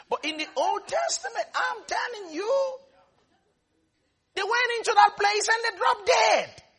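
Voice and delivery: high, insistent, building into a shout; rising preaching cry. Real, not acted. Preacher warning of deaths in the Old Testament, shouted. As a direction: climb high and build to loud.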